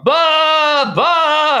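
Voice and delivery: high, loud imitated bleat; sheep bleating. Ewes following the farmer's cart, loud high bleating. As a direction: bleat like a grieving ewe. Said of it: "They're going".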